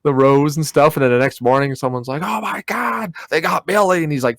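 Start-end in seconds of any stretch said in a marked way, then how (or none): 2.2-4.0 mock horror. Someone finds the victim next morning, high-pitched mock horror. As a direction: play a shocked witness in a horror film. Acts out horrified discovery of victim.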